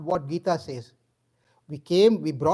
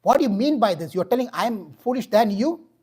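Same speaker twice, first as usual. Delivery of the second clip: loud, higher indignant demand; acted outrage, raised voice; enraged king; 4 semitones higher, 6 dB louder than usual. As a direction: thunder it like an insulted king. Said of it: "really raged with anger".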